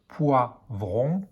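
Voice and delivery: "poivron" as poi-vron, with a gap; split into syllables. Shown as a demonstration. Teacher showing pupils the syllable heard, word split in two. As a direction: pause between the syllables of "poivron". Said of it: "on entend la syllabe".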